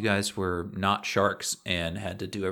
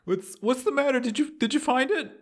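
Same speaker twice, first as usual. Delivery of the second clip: much higher, anxious little voice; high pleading collar voice; 13 semitones higher than usual. Acted.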